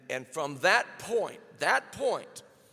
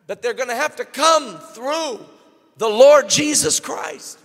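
louder and higher, the preacher building to a roar; full-force preaching; 6 semitones higher, 10 dB louder than usual. Acted. Preacher thundering to the congregation about the gates of the New Jerusalem. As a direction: drive it home from the pulpit.

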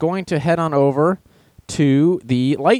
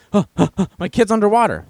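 high, out-of-breath mock panic; mock running panting; 8 semitones higher than usual. Parent running to the front desk, mock breathless plea. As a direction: pant it out like someone running for help. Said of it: "running like".

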